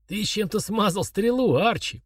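harsh croak. Acted. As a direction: croak it out in pain. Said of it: "прокаркал он".

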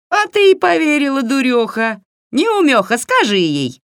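very high, loud, jeering hag; shrieky witch taunt; mocking witch. A narrator's reading. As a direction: jeer at the fool as a witch. Yaga mocks the gullible girl.

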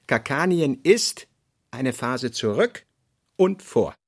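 ordinary delivery

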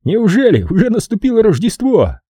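high, mock-amazed lilt; Kirk's nasty singsong. A narrator's reading. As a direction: sneer it through a smirk, pitched high. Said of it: "пропел Кирк с мерзкой улыбочкой". (высоко и издевательски, с улыбочкой)

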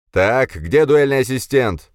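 loud, high, stretched-out call; fussing call-out. A narrator's reading. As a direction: call across the room for the assistant. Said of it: "засуетился мужик".

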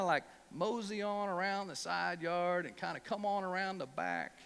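lightly voiced old preacher, easy and calm; southern preacher. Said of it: "he goes".

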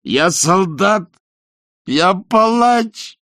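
put-on high, drawn-out drunken shriek. A narrator's reading. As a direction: shriek it out, beating your chest. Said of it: "завизжал он и стукнул себя кулаком в грудь". (пронзительный визг)

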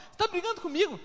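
high, mock-hurt question; a lightly put-on offended wife; wife's complaint. Pastor quoting his wife's hurt question about fighting. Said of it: "Aí ela fala assim".

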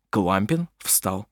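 ordinary delivery

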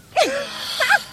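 high-pitched burst of laughter; gleeful laugh. Acted. Woman gossiping to her friend, high gleeful laugh.

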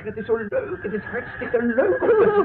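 high, giddy laughter through the words; laughing with joy. Acted. Husband laughs with joy as he flies round the room. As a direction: crow it with childlike delight. Flying man delighted.

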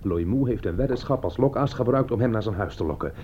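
usual speaking voice

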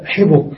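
"حبك" said ḥibuk, i then u; mixed kasra and damma. Shown as a demonstration. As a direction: say "حبك" with short i on ح and short u on ب. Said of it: "فصار كما رأينا هنا". (نطق "حبك" بكسر الحاء وضم الباء)

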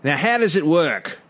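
put-on Cockney husband; hammed-up Cockney-Australian; Cockney accent. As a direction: question the seller in mock Cockney. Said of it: "it's, it's Cockney".

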